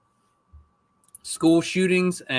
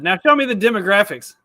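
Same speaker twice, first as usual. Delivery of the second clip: higher, louder quote of his own demand; retold demand; 7 semitones higher, 7 dB louder, faster than usual. Dad retelling his request for his daughter's numbers, insistent. Father quoting himself to daughter.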